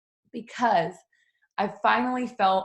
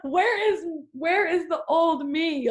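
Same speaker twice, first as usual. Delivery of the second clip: high, slow, hammed-up lament; mock lament; 10 semitones higher, 3 dB louder, slower than usual. New mom mourning her old self, high and slow. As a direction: stretch it out high, half-laughing despair. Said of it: "who are like".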